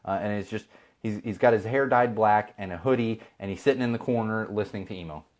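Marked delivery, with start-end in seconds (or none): none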